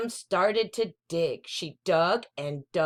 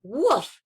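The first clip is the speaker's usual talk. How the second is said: higher, louder happy bark; puppy woof; 5 semitones higher, 5 dB louder than usual. Acted. Puppy greeting the little boy, happy woof. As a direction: give a friendly yip.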